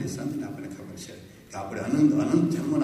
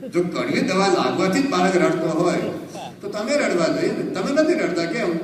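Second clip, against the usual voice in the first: raised, higher, louder preaching at full force; fervent preaching; 8 semitones higher, 7 dB louder than usual. Acted. Preacher to the assembled devotees, fervent loud preaching. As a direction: preach it out to the whole hall.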